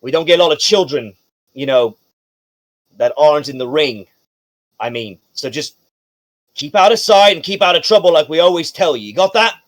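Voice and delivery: emphatic doorman voice, loud at first and then settling; apologetic, cautioning guard. Acted. Casino guard letting Miss Loon through, emphatic and familiar. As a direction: explain yourself as an indulgent guard.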